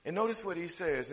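usual speaking voice

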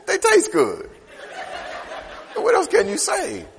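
chuckling through the punchline; laughing. Real, not acted. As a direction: laugh it out, amused. Joke about chickens, laughing.

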